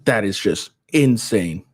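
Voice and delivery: ordinary talk, nothing marked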